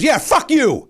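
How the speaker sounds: retold angry retort. Other golfer swears back at him, angry. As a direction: fire back the insult, acting it out.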